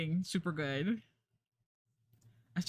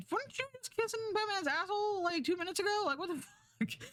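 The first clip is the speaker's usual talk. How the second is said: incredulous mock; 13 semitones higher, faster than usual. Mocking fickle crowd.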